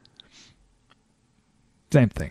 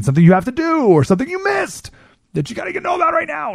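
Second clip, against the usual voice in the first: high, hurried mock alarm; mock urgency; 13 semitones higher, faster than usual. Mimicking urgent notifications, high and fast. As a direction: rattle it off like a panicked alert.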